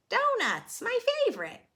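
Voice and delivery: high, bright-eyed delight; excited character voice. Acted. Clyde greeting the plate of donuts, delighted. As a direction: squeal over the treat. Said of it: "says Clyde".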